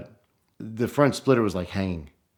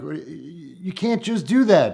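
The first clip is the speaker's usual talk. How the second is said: nervous protest; 8 semitones higher than usual. Retold or imitated. Panicked protest to friend.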